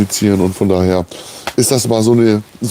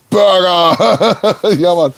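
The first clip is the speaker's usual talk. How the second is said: high, laughing burst of joy; laughing cheer; 7 semitones higher, much slower than usual. Real, not acted. (lachend und begeistert)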